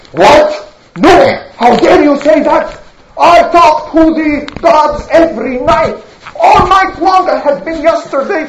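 acted offended bluster; indignant. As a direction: protest as if gravely insulted. Rival acts outraged at Nuh.

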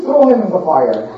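ordinary delivery

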